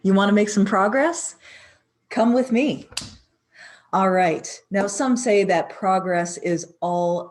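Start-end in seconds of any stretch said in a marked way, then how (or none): 0.0-4.5 loud, punchy greeting to the viewer; loud hype call. Acted. Host hyping up the viewer, loud and punchy.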